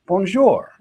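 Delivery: bonjour said the French way, falling at the end. Shown as a demonstration. Wife teaching the French greeting, bonjour said in French. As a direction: give bonjour a French accent. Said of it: "usually it starts with".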